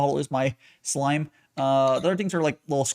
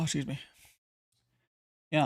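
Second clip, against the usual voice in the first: ordinary talk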